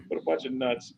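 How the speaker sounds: ordinary talk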